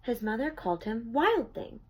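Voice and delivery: ordinary delivery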